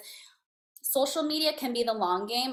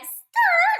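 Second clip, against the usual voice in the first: a very high, loud squeal; excited squeal; 9 dB louder than usual. Retold or imitated. Hearing her gym friend is a hairstylist, squealing. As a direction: shriek with delight at the news. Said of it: "I was like".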